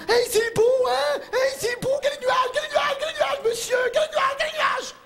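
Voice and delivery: high, loud, shouting with glee at the clouds; hammed-up gushing over every cloud; mock excited passenger. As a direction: squeal like a thrilled kid at the window.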